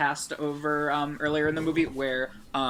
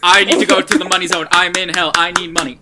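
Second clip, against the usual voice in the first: loud, higher hammed-up panic; mock yelling; 5 semitones higher, 14 dB louder, faster than usual. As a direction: yell it fast as a joking imitation. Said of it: "kind of yelling".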